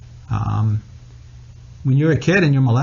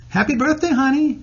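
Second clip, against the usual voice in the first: high-pitched imitation of mom on the phone; put-on high mom voice; mother's voice; 14 semitones higher than usual. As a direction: lilt it up high as a doting mother. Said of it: "my mother called me one morning".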